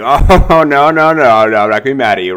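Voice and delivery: loud high laughing no, no, no; laughing denial. Retold or imitated. Client laughing off the salesman's joke, loud and high.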